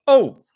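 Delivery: short, loud, high "oh"; coworker's high "oh". Retold or imitated. Imitating a guy at work, short high "oh". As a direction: pop out a high hooted "oh". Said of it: "He always goes".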